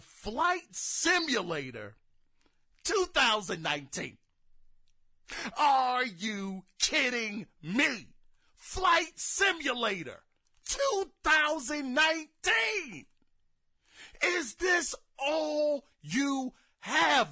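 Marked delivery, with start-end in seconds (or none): 5.6-17.3 high, drawn-out incredulous rant; outraged disbelief. Real, not acted. Gamer ranting at Microsoft over Flight Simulator, incredulous. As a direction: hammer it out slowly, pitched up in exasperation.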